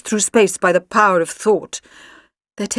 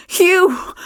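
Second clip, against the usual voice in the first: delighted; 11 semitones higher than usual. A narrator's reading. Joyful reunion with Luke.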